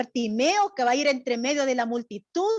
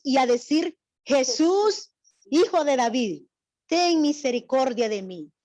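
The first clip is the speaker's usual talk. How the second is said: slow raised reading with the cry of Jesús lifted; scripture reading; 2 semitones higher, 3 dB louder than usual. Acted. Teacher reading how Bartimaeus cried out, raised and slow. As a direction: read it out as a proclaimed verse.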